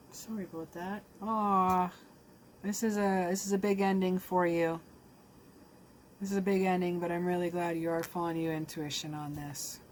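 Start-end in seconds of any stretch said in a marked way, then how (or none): 0.1-7.0 pushing on through real welling feeling; choked up. Real, not acted. Reader tells the viewer of a big ending, choked up. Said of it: "I'm a little choked up".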